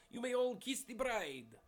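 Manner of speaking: loud, high, slow mock Russian accent; bad Russian accent. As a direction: ham up a Russian accent, calling out in unison. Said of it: "excuse to do a bad Russian accent".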